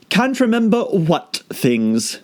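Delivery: unhurried quizzing; caterpillar voice. A narrator's reading. Caterpillar questioning Alice, slow.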